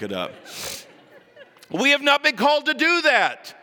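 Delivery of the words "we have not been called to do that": much higher and louder, proclaiming; fiery preaching. Real, not acted. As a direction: rouse the congregation. Preaching climax to church.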